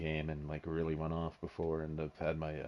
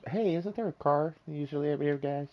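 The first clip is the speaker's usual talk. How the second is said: raised playful voice calling friends; high mock call; 9 semitones higher, 5 dB louder than usual. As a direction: pitch up, call out to the group. Mimics player looking for car.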